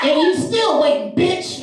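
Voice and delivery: high-pitched cutting punchline; little girl's roast. Retold or imitated. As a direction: imitate a kid snapping the punchline. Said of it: "looks at this girl and she says".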